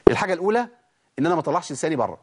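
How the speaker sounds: ordinary talk, nothing marked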